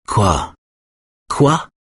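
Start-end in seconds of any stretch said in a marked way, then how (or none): 1.3-1.7 annoyed. A narrator's reading. Wife repeating her husband's question, annoyed. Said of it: "répète-t-elle agacée".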